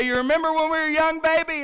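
a husband teasing his wife; a hint of a sweet-talking dad; playful dad. Dad to mom at red light.

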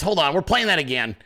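normal talking voice

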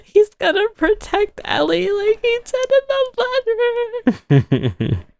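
retelling her own crying in a very high, drawn-out squeal; squeaky mock sobbing, slowed and stretched; imitated tearful wail. Host recalling how she turned to Joe over the show, tearful wail. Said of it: "Cried my fucking eyes out".

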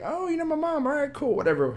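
high mock-polite brush-off; sarcastic. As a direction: fake interest, high and dismissive. Mock reply to girl.

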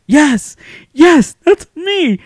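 high, loud, delighted yeses; acted thrilled reply. Pupil answering the teacher who remembered him, high and excited. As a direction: burst out with joy at being recognized.

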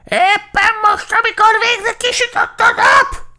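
piercing high crow screech; loud, furious squawking; angry. A narrator's reading. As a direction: shriek in outrage at being disturbed. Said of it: "mondta dühösen".

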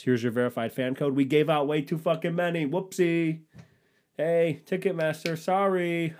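high, sheepish corporate voice; breezy high apology, playing dumb; mock sheepish company. Acted careless Ticketmaster.

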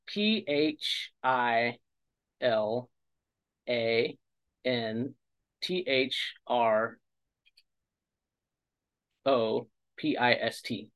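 slow careful letter-by-letter; spelling it out. Shown as a demonstration. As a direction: spell out each letter slowly. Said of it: "Okay, philanthropist".

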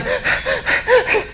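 high-pitched giggle; a quick squeaky laugh. Real, not acted. Preacher laughing at the scene, high giggle.

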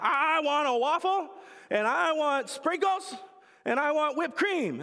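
put-on high, loud whiny little-girl demand; demanding toddler. Toddler demanding her waffle from dad, high whiny voice. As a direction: whine it out like a hungry toddler.

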